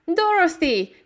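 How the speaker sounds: high joyful call; crying out. A narrator's reading. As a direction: call her name in surprise. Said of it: "cried Aunt Em".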